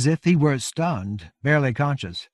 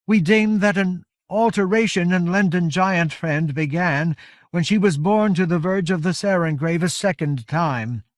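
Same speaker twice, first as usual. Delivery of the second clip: raised, loud, weary giantess voice; exhausted giant leader; 7 semitones higher, 5 dB louder than usual. A narrator's reading. Iron Hand Coldspray pleading to Covenant, weary and solemn. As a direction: speak with spent, solemn strength.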